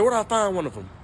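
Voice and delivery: lightly voiced granddad pressing the question; eager granddad. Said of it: "He said".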